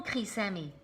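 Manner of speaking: usual speaking voice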